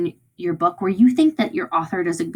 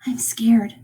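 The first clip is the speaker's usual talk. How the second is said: hushed, voiceless confiding; scared whisper; 4 semitones higher than usual. Shown as a demonstration. [name] confides his fear to his brother, whispering. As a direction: whisper it like a frightened secret. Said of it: "like when I whispered".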